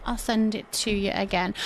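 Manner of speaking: ordinary delivery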